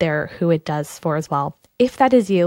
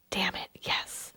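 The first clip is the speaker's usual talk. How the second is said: whisper; 14 dB quieter, slower than usual. Pretend. Listener's imagined inner reaction.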